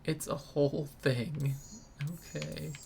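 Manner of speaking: usual speaking voice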